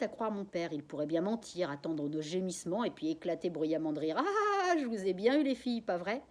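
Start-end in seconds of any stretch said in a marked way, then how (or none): 4.2-6.2 high, loud teasing gotcha; spring the joke on the girls; mock triumphant joke. Father pretending a joke to his daughters, teasing gotcha.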